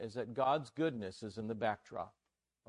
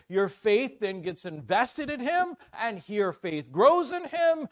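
building to loud, high-pitched preaching; impassioned preaching; 8 semitones higher, 8 dB louder than usual. Real, not acted. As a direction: preach it with rising force, punching each phrase.

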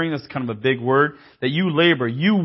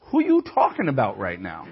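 rising, louder indignant challenge; mock confrontation; 7 semitones higher, 3 dB louder than usual. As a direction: get up in their face, defending your own. Family defending him, acted quarrel.

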